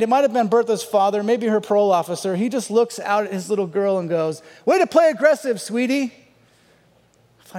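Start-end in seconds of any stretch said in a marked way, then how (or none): 4.6-6.1 high, loud cheering-dad imitation; retold shout of encouragement; mock sideline cheer. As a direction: call it out like a proud sports dad. Retold father cheering his daughter.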